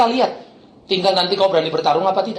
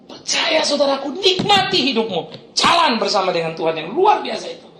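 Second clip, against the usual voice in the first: high, stretched preacher's plea; fervent exhortation; 6 semitones higher than usual. Real, not acted. Preacher urging the congregation to trust, fervent exhortation. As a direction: urge them on with ringing conviction.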